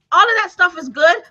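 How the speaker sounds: ordinary delivery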